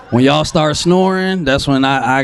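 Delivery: ordinary talk, nothing marked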